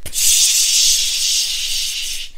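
long hissing psh; sustained voiceless whoosh; freezer jet spray. Pretend. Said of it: "pretend you've got freezer jets and go".

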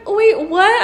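high, loud, disbelieving; stunned. Retold or imitated. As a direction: snap into disbelief. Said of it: "I'm like".

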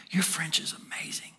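hushed whisper of the compliment; very soft, voiceless murmur; whispered aside. Retold or imitated. Repeats the compliment under breath.

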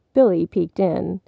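ordinary delivery, nothing marked

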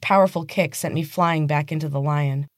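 ordinary delivery, nothing marked

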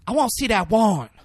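high, loud demand for the warrant; mock the defiant woman's demand; tough woman demanding. [name]'s character demanding the warrant.

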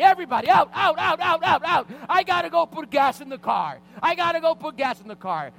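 high, full-force repeated yelling; freaking out. Retold or imitated. Father yelling at kids behind him, freaking out. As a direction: order everybody out at the top of your voice. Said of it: "I turned to my kids behind me".

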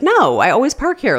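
high, loud mocking husband voice. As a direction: parrot his stubborn excuse. Husband stubbornly defending parking spot.

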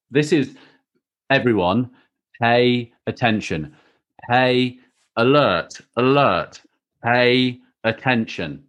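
slow, spaced-out mock announcement; mock public alert. Co-host alerting listeners to the important part, slow mock announcement. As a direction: announce it word by word, playfully.

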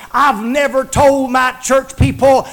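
ordinary delivery

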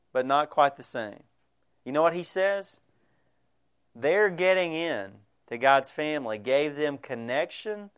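ordinary delivery

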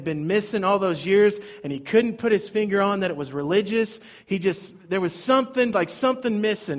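fervent pulpit preaching; preaching at full force. Acted. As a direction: preach it out to the congregation. Pastor builds the sermon passionately.

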